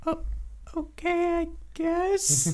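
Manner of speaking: small, high creature voice, unsure; hesitant kobold. Acted. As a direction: reluctantly give in, high and squeaky. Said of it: "and he just goes".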